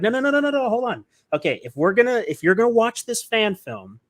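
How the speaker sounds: high-pitched run of no's, then insistent; urgent halting. Retold or imitated. Son stopping dad before he watches the fan film, urgent and high. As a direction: stop them in their tracks, voice shooting up.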